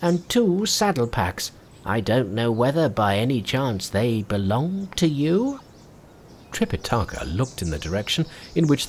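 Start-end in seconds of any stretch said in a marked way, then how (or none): 0.0-5.6 put-on old man voice. A narrator's reading. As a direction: soothe him like a gentle elder. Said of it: "said the old man".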